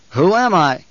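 loud, forceful demand. A narrator's reading. Paul pressing the captive officer to name him, loud demand. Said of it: "Paul demanded".

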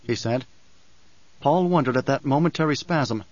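plain reading voice

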